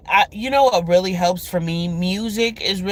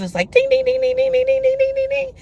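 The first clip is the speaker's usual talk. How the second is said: pinball dings; faster than usual. Retold or imitated. Imitates pinball machine sounds.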